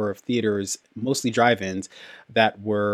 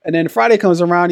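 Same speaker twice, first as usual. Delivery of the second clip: ordinary talk, nothing marked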